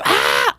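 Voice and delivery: high, loud mock squeal of delight; imitated excited shriek. Imitating Mike thrilled about the drama, high mock squeal. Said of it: "he does this laugh that's like".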